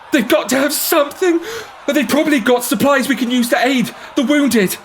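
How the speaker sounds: high shouting crowd voice; rabble shouting. Acted. Rabble to the barge crew, high desperate shout. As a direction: push demanding supplies.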